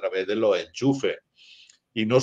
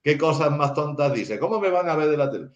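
higher-pitched dismissive retort to grandma; mocking granddaughter; 6 semitones higher than usual. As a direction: imitate a granddaughter scoffing at grandma. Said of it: "se reían de la viejita, decía".

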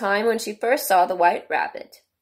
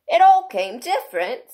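higher-pitched musing with weight; thoughtful; 8 semitones higher, 6 dB louder than usual. A narrator's reading. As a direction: mull it over aloud. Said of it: "repeated thoughtfully".

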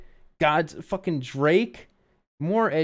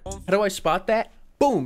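usual speaking voice

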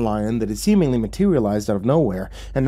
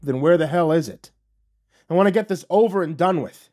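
higher-pitched, frustrated demand; exasperated; 7 semitones higher than usual. Acted. Narrator wanting quest over, exasperated with devil. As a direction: demand it, thoroughly fed up.

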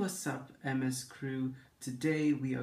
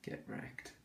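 whispered taunt; 12 dB quieter than usual. Real, not acted.

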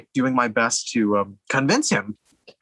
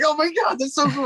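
much higher, gushing inner fanboy; giddy excitement. Retold or imitated. Young director privately thrilled by the actress's stunt question, giddy. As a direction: squeal it like a starstruck teen.

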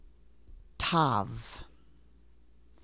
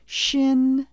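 Shin called out louder and higher; emphatic Shin; 9 semitones higher, 6 dB louder than usual. Shown as a demonstration. Teacher calling out Shin for learners. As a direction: call out Shin for the echo. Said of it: "Repeat the last letter after me".

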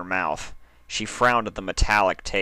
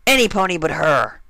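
angry; 9 semitones higher, 7 dB louder than usual. Acted. Dash refusing, pointing.

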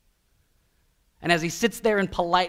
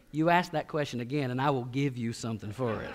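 low, softer warning to a son; father's warning; 6 semitones lower, 6 dB quieter than usual. Retold or imitated. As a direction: lay down a quiet threat, dropping at the end. Father warns his son in retold exchange.

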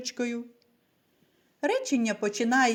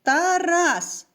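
"Тарас" with the second syllable punched, loud and high; "Тарас" said loud, leaning on the second syllable; stress on the syllable; 7 semitones higher, 6 dB louder than usual. Shown as a demonstration. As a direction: hit "рас" in "Тарас" hard and loud. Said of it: "Скажімо разом".

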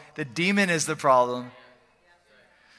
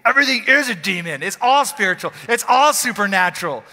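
1 mock preacher; 3 semitones higher, 6 dB louder than usual. Mocking eighties preacher.

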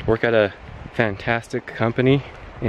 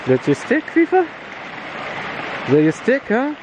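high dog-talk voice; 13 semitones higher than usual. Real, not acted. Asking the dog about her stick.

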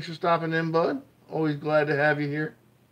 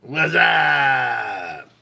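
shout; 8 dB louder than usual. Real, not acted. Shouted greeting to chat.

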